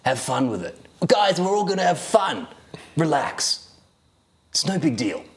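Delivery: lightly put-on Australian teacher, reassuring; a breezy Australian teacher calming a pupil; Australian accent. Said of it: "terrible Australian accent".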